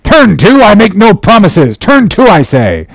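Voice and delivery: high insistent captain's order; commanding refusal. A narrator's reading. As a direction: insist sharply that they obey. Captain refuses, repeats his order.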